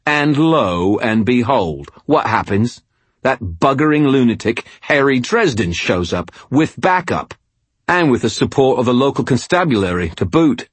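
put-on rising, exasperated English-accented rant; annoyed. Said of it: "his voice annoyed".

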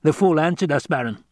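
lightly voiced tenor henchman; lilting courtier passing on news; Piter. Henchman reports news to his master.

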